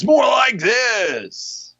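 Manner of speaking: high, slow booming shout; arena announcer. Shown as a demonstration. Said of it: "that's not more arena".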